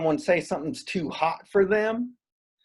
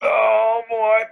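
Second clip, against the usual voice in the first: crying; 4 semitones higher, 3 dB louder than usual. Acted. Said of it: "you are crying really aggressively".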